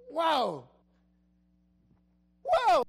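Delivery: loud, high burst of amazement; excited wow. Shown as a demonstration. Pastor showing how to react to the profit, thrilled shout. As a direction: shout it like you can't believe the money. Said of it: "I will get excited".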